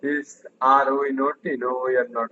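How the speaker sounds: slow, careful drill phrasing; slow model question. Shown as a demonstration. Teacher drilling a question and answer, slowly. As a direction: lay out each word slowly. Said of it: "teacher models question drill".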